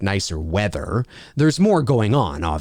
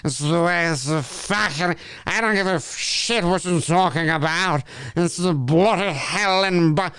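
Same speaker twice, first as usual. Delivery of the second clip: boozy, hammed-up British rambling; drunk British caricature; 3 semitones higher than usual. Shown as a demonstration. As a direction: trail words into each other like a sloshed Brit. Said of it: "drunk, like I'm completely inebriated".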